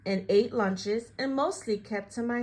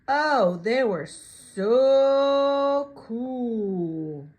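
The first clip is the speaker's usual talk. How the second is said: louder and very slow; awestruck; 6 dB louder, much slower than usual. Acted. Narrator in awe of the cool beans, loud and drawn out.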